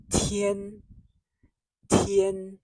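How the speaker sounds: tian high and level; first tone tian. Shown as a demonstration. Teacher models tian for beginners, high level tone. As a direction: hold tian high and steady. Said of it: "you'll say that it's a first tone".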